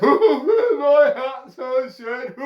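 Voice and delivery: high, squealing monkey voice; mimicking monkeys. A narrator's reading. Monkeys shaking fists back at the hatter, mocking mimicry. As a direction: parrot it in a loud monkey wail. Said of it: "they're copying me".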